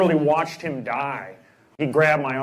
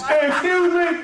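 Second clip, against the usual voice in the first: talking over a fence; 14 semitones higher than usual. Retold or imitated. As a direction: call out over a fence to a neighbor. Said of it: "like they're talking over a fence".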